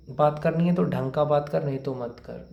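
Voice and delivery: ordinary delivery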